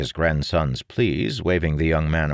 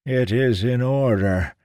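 put-on higher, thin old man; a creaky fortune-teller; old seer voice; 8 semitones higher, 3 dB louder than usual.

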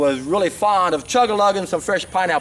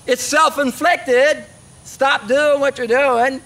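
slow, high-pitched, word-by-word emphasis; emphatic admonishing; 7 semitones higher, 3 dB louder, slower than usual. Real, not acted. Telling viewers their problems are self-inflicted, emphatic admonishing. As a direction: drive it home slowly, pitched up.

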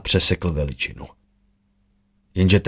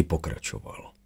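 ordinary delivery, nothing marked

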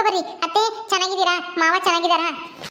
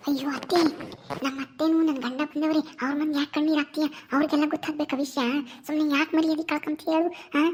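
low, softened voice, keeping it private; urgent aside so the visitors don't hear; hushed warning; 3 semitones lower, 6 dB quieter than usual. Acted. Daughter-in-law hushing her weeping mother-in-law before relatives, hushed warning.

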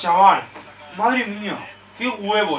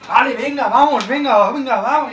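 loud, higher urging; rallying shout; 5 semitones higher, 6 dB louder than usual. Real, not acted. Fan rallying Barça after the miss, with a loud urging voice. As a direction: pump the team up.

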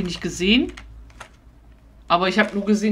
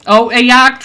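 high, loud urgent alarm; panicked warning; 6 semitones higher, 15 dB louder, slower than usual. Real, not acted. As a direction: shout the danger. Warns teammates of hunter.

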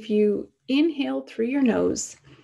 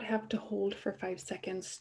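whispering; 10 dB quieter than usual. Real, not acted. Teacher drops to a whisper.

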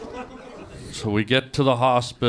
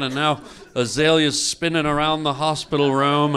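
normal talking voice

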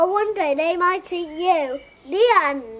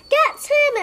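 higher-pitched mock boom; booming command; 7 semitones higher than usual. A narrator's reading. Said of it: "it boomed as it bounced along".

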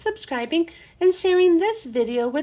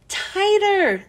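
high shout, a bit louder; 8 semitones higher, 3 dB louder than usual. A narrator's reading. Class shouting at Stephen to pull harder, high shout. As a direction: urge him on with a high shout. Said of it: "they all shouted".

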